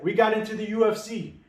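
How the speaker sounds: shouting the news, high and loud; excited shout. Retold or imitated. A fighter yelling to his father that he made it. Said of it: "He's like".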